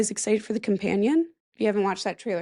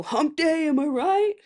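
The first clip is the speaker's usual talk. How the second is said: loud, higher goofy camel voice; quoting camel ad; 6 semitones higher, 4 dB louder than usual. Retold or imitated. Quoting the Geico commercial camel to listeners, goofy. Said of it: "that commercial".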